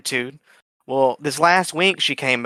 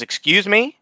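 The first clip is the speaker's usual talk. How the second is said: raised, offended pitch; indignant excuse me; 6 semitones higher, slower than usual. Retold or imitated. Customer affronted that the cashier refused her.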